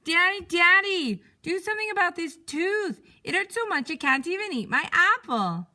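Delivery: high, loud cry of tooth pain; loud, high yelping child in pain; pained yell. Acted. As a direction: wail for Mommy like a hurting kid. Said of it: "and yelled".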